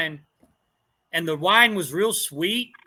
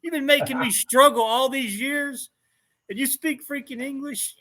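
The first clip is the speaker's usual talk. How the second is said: mock outrage; 7 semitones higher than usual. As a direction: playfully blow up at him, half laughing. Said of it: "I'm like".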